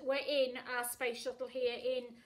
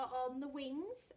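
ordinary delivery, nothing marked

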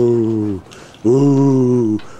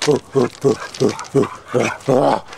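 ordinary talk

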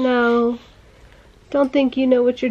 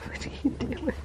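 whisper; 3 semitones higher, 12 dB quieter than usual. Real, not acted. Mother whispers to the napping baby girl, hushed. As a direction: whisper so she doesn't wake.

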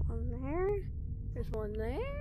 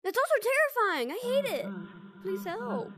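panicked high yelps; freaking out at a jump scare; scared horror game streamer; 10 semitones higher, 5 dB louder than usual. Real, not acted.

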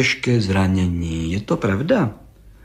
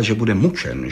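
ordinary delivery, nothing marked

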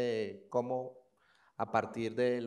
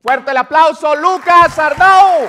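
high, loud, drawn-out announcer shout; announcer call; 21 dB louder, slower than usual. Acted. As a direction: call the name like an emcee. Host announces guest for applause.

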